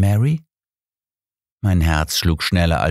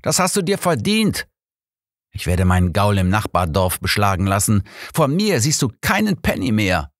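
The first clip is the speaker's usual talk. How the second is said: scolding; 8 semitones higher than usual. Acted. Smitherson scolds the drunk Jack, angrily. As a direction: berate the drunk, voice pitched high. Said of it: "schimpfte Smitherson".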